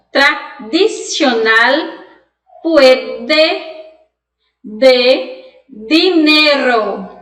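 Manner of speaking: very slow, every D hard and dental; Spanish pronunciation. Shown as a demonstration. Teacher slowly models the Spanish words for students to repeat. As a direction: say "Tradicional puede de dinero" slowly with a clean Spanish D. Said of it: "vai pronunciar di".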